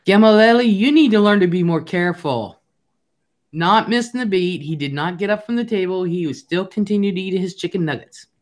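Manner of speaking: put-on child's voice lecturing grandma. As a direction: play a bossy three-year-old lecturing grandma. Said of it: "And then here's Zaya".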